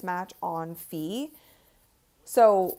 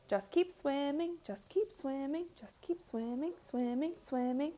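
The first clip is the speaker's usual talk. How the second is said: Dory singsong; 5 semitones higher, 8 dB quieter than usual. Retold or imitated. Streamer quoting Dory from Nemo, soft singsong. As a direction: chant it like Dory. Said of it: "Dory the fish from Nemo".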